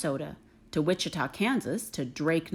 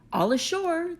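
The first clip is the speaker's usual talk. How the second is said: a higher sailors' call to land; mild shout; 9 semitones higher than usual. Acted. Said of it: "the men shouted".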